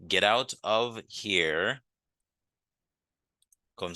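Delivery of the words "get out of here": slow demo. Shown as a demonstration. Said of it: "that American dit".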